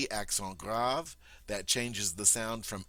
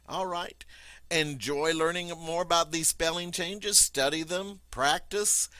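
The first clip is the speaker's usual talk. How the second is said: upbeat sign-off; 7 semitones higher, 4 dB louder than usual. Acted.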